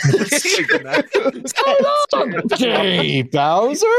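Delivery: very high, drawn-out hammed-up Italian; laughing, stretch it out high and slow; Mario with venom. Shown as a demonstration. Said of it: "how much venom he puts".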